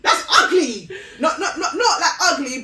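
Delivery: loud and high, laughing through it; loud laughing insistence; laughing exclamation. Real, not acted.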